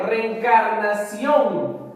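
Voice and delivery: reencarnación slowly sounded out, CIÓN drawn long; stress on CIÓN. Shown as a demonstration. Teacher showing the strongest syllable, reencarnación stressed on CIÓN. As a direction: stretch reencarnación and lean on the final CIÓN. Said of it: "su mayor fuerza de voz es justamente".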